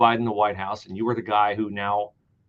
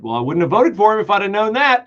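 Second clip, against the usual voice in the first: a higher, louder mock-regretful voter; mock voter quote; 7 semitones higher, 7 dB louder than usual. Host voicing a misled voter, in a higher, louder mock quote. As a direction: voice a voter who feels misled. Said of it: "the American electorate has since said".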